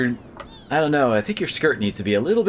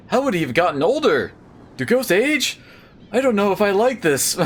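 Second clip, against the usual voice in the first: higher-pitched, wordy henchman voice; fussy doubtful henchman; 7 semitones higher than usual. Acted. Henchman questioning his boss about a ghost, fussy and doubtful. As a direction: play a doubtful underling in a high voice.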